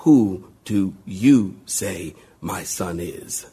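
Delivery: low, slow, solemn divine voice; voice of God. Acted. God questions each person at judgment, low and slow. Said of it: "God will look at every single one of us and say".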